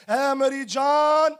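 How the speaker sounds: drawn-out, self-addressed call; foolish rich man. Acted. Preacher voicing the foolish rich man to his soul, drawn out. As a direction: stretch it slowly as the complacent fool. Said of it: "वो पता क्या कहता है नादान शख्स".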